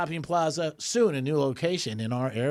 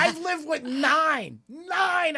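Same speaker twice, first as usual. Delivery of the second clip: incredulous emphasis; 11 semitones higher, much slower than usual. Real, not acted. Stunned disbelief, repeated number.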